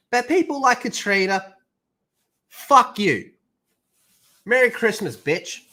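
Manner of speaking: mocking sneer. Real, not acted. Mock farewell insult to fired woman.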